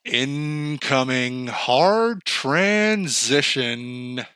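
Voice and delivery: very slow, low, soft system alert; mock warning announcement. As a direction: drone it out like an incoming alert. Mock announcement of topic change.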